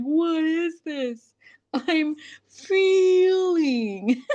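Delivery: high, slow, drawn-out Grinch whine with a crying edge; Grinch impression. Quoting the Grinch discovering feelings, high, slow, teary whine. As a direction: stretch every word like the bewildered Grinch. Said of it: "like that moment in The Grinch ... with Jim Carrey".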